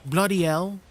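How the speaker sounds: playful put-on British "Bloody hell"; tossing off a British phrase for the chat; mock British exclamation. Streamer trying out British slang for chat, mock accent. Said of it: "I don't know what else British people say".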